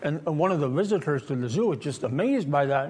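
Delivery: ordinary talk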